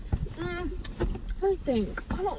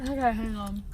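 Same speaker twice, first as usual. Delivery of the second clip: talking through a mouthful of rice; words round a bite of rice; mouth full; 4 semitones lower, 6 dB quieter than usual. Real, not acted. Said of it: "There's rice in my fucking mouth".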